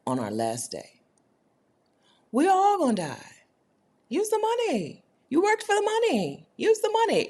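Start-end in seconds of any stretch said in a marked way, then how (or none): 2.3-7.3 high, insistent push to spend; emphatic urging. Real, not acted. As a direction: pitch it way up and drive it home. Urging viewers to spend money.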